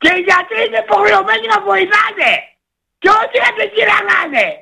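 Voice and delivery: angry shouting. Real, not acted. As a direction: yell your grievance at the radio host. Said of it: "Μη φωνάζεις". (φωνάζει θυμωμένα στον παρουσιαστή)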